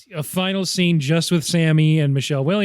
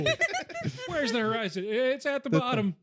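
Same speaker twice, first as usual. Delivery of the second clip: high gruff director; 8 semitones higher, 8 dB quieter than usual. Retold or imitated. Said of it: "Lynch as John Ford".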